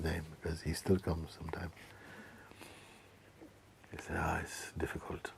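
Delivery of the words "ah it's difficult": a tired, whispered sigh of a line; weary whisper. Retold or imitated. Friend admitting trouble with girlfriend, in a whisper. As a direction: breathe it out defeated.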